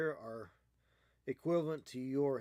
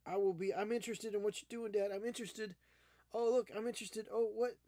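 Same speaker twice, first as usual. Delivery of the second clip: higher, fast, eager little cat voice; voicing the cat; 7 semitones higher, faster than usual. Owner voicing his cat's thoughts in a high eager voice. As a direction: play the curious cat, chirpy and quick. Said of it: "Oh, now you're like".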